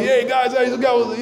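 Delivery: high, bright greeting of the outgoing brother; high party-guy hello. Acted. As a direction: toss it off like the life of the party. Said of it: "the life of the party".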